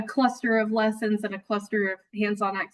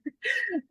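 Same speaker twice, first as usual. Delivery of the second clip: sudden high nervous laugh; jumpy laugh after a fright; startled laugh; 13 semitones higher, 5 dB quieter than usual. Real, not acted. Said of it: "Oh, it scared me".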